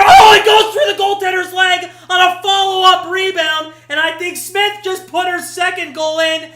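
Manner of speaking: loud, high shouted play-by-play; excited goal call. Real, not acted. Commentator calls a rebound goal to stream viewers, excited shouting. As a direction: erupt as the ball goes in.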